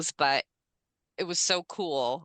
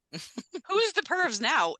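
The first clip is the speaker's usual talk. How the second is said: laughing; 10 semitones higher, much slower than usual. Real, not acted. Laughing at group's dirty talk.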